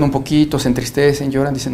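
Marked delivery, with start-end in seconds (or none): none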